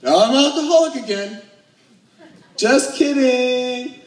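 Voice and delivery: weekend glee. Retold or imitated. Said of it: "I'm like".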